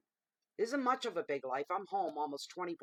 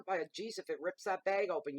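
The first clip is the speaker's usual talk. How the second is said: ordinary talk, nothing marked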